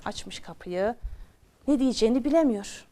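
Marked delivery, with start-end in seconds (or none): none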